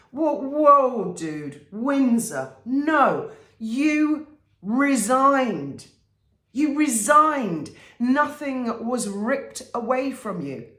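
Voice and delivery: loud, high, drawn-out protest; slow it right down and punch each word in disbelief; exasperated rebuke. Acted. Objecting to Harry's claim of being ripped away, exasperated.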